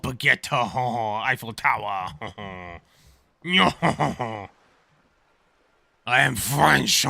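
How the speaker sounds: hammed-up cartoon French accent with honking laughs; loud mock-French, a "hoh-hoh-hoh" after each phrase. Shown as a demonstration. Streamer showing off a best French accent on request, hammed-up. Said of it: "Give us your best French accent".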